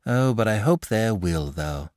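hoping for a fight, lightly wistful. A narrator's reading. Little fellow hoping for a fight, mildly wistful. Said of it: "replied the little fellow wistfully".

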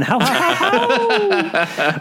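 high, slow squeaky ho-ho; squeaky werewolf laugh. A narrator's reading. Narrator mocks a werewolf laugh, squeaky like chalk. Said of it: "sounds more like chalk squeaking on a blackboard".

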